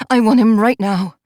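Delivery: ordinary delivery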